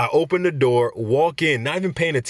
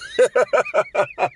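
high wheezy laugh whistle; whistling giggle; 11 semitones higher than usual. Real, not acted. Laughing at voting booth joke, wheezy whistle giggle. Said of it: "That little laugh whistle".

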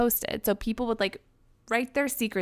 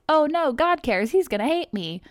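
mock panic; 8 semitones higher, 4 dB louder than usual. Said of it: "And they're like".

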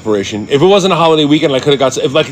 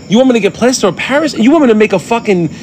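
high-pitched, incredulous questioning; mock-panicky disbelief, pitched way up; retold panic; 9 semitones higher than usual. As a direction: act out being overwhelmed by instructions. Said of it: "When he said that, I got panicky".

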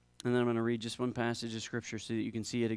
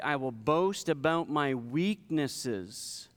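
raised, drawn-out rhetorical questions from the pulpit; emphatic scripture reading; 4 semitones higher, slower than usual. A narrator's reading. Preacher reading Paul's questions about weakness, emphatic scripture reading. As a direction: put each question weightily to the listeners.

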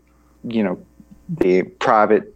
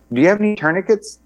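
high, fast, eager mock soldier asking; eager soldier question; 9 semitones higher, faster than usual. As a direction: fire off the question quickly and keenly, pitched up. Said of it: "They're like".

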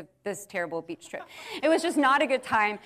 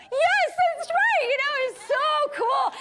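joyful cheer; 6 dB louder than usual. Retold or imitated. Celebrating the girl's new faith, loud joyful shout. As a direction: cheer it out in delight. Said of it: "And I was like".

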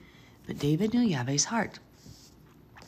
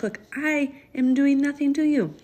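high, louder pleading voice; pleading cry; 10 semitones higher, 4 dB louder than usual. Acted. David pleading with King Saul, high and urgent.

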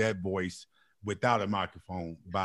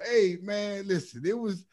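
higher-pitched playful seventies jive; seventies hip cat; 8 semitones higher than usual. Retold or imitated. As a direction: lightly imitate a cool cat stuck in the seventies. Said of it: "his terminology was still stuck in nineteen seventy".

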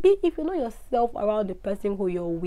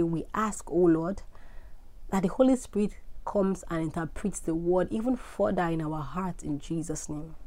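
softer, hushed prayer voice; 5 dB quieter than usual. Acted. Host leads listeners in a closing prayer, soft and devout. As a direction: pray to God intimately, gently.

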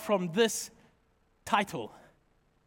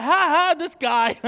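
louder, higher laughing imitation; mock laughing; 4 semitones higher, 5 dB louder than usual. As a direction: laugh at the groom as the bride did. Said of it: "she was laughing at me".

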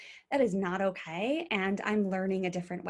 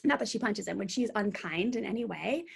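ordinary talk, nothing marked

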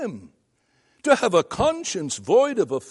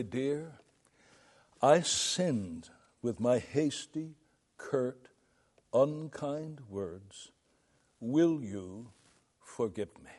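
softer, slow and earnest apology to the wife; sincere confession; 3 semitones lower, 9 dB quieter, slower than usual. Shown as a demonstration. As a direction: own it quietly, meaning every word. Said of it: "you own your sin and you say".

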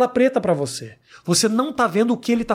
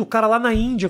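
usual speaking voice

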